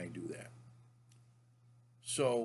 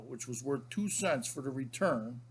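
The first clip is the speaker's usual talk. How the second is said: usual speaking voice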